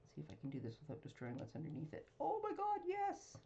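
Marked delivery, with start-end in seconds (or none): 2.2-3.4 high delighted squeal; thrilled, voice jumping up; excited squeal. Real, not acted. Delighted with bootlaces idea.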